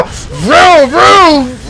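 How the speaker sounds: long, rising and falling vroom; high, drawn-out engine noise; imitated engine revving.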